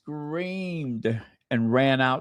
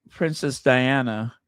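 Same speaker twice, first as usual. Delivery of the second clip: normal talking voice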